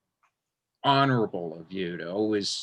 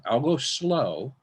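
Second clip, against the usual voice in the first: mock slow; 3 semitones higher, slower than usual. Teasing promise to teach slowly.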